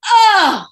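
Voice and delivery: loud high mock groan of a room; a whole room groaning oh; imitated groan. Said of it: "this is all I hear".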